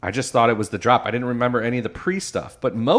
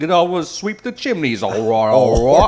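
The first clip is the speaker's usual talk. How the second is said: loud mock Cockney chimney sweep; bad English accent; 7 semitones higher, 8 dB louder than usual. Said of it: "Like, real bad English accent".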